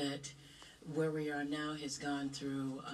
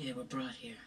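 whispering; 4 semitones lower than usual. Real, not acted. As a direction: breathe it out hushed. Hushed reply during ghost contact.

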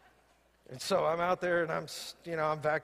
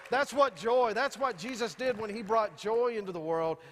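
higher, louder preaching; 5 semitones higher, 4 dB louder than usual. Acted. Pastor rousing the congregation about joy, fervent preaching. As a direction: lift the congregation with rising fervour. Preaching builds to full force.

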